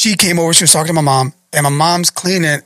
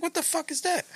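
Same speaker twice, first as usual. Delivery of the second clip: high, very soft squeak; retold wary question under the breath; hushed alarm; 12 semitones higher, 15 dB quieter than usual. Mom noticing rustling in the closet, hushed alarm.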